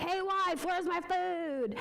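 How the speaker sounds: put-on high, slow, slurring drunk calling for his wife; a drunk husband barging home at midnight, hollering high and slow. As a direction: stumble in sloppy drunk, demanding food. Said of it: "the man comes stumbling in drunk".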